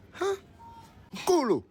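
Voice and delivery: normal talking voice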